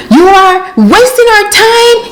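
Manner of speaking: mocking the supervisor's angry group chat text; mocking the boss. Mocking boss's group chat message.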